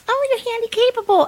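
very high, cutesy imitation of well-meaning people; mocking high-pitched gushing; mock condescension. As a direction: coo it high, as if to a child. Imitating people using the euphemism.

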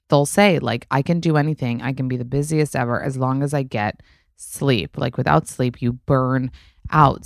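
upbeat sponsor read. Acted. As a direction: pitch the product warmly.

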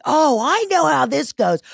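high, drawn-out "I'm onto you" comeback; sarcastic, high-pitched retort; knowing indignation. Retold or imitated. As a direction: call out a scammer, slow and high. Acted indignant comeback to suspected scammer.

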